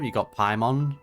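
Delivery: ordinary talk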